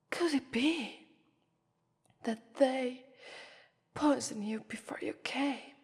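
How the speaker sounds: ordinary delivery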